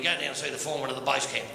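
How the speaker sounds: low, quick lumberjack voice; matey, pitched well down; lumberjack mate. Acted. Lumberjack advises his mate in joke.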